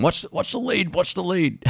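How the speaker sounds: high, hushed mock-panicky warning; mock-anxious squeal, kept soft; paranoid warning, imitated. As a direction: fret at your bandmates in a high, small voice. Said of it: "I was so paranoid about".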